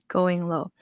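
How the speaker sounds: ordinary talk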